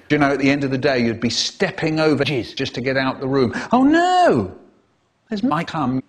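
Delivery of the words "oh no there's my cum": mock dismay.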